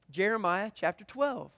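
normal talking voice

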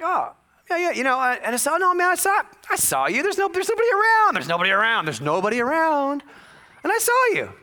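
high-pitched indignant insisting; defensive protest. Retold or imitated. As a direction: insist you saw nobody around. Defending himself to the stranger.

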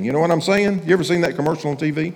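normal talking voice

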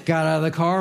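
ordinary delivery

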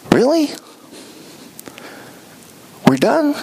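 high incredulous "Really?"; mock surprise. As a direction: ask in mild disbelief. Jesus questions disciples.

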